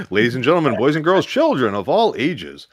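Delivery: mock ringmaster introduction, loud and swooping; announcer voice. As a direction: belt it out like a circus announcer. Joins in the mock announcer bit.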